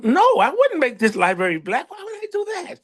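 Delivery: high-pitched protest of innocence; mock innocent denial. Acting out his innocent denial.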